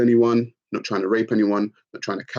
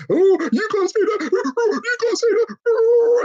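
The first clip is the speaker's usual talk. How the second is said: much higher, slow, fake-crying whine; mock crybaby whine; 4 dB louder than usual. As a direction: whimper like an offended crybaby, hammed-up. Mocking sensitive men whining.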